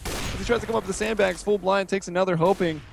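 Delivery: fast rising play-by-play call; esports caster. Acted. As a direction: caster hyped on a no scope. Live play-by-play of a clutch.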